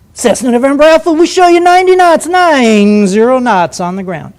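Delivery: high, loud, self-important radio controller; sing-song controller voice. Retold or imitated. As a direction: sing it out as if to a famous astronaut. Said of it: "they do that sing-song voice".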